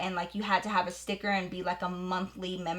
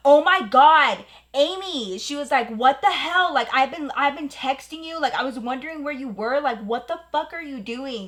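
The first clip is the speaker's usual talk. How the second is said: higher-pitched, dismayed scolding; exasperated shock; 5 semitones higher, 4 dB louder than usual. Retold or imitated. Roommate finding her friend on the car, appalled scolding. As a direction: act out a roommate's appalled discovery, starting loud then easing.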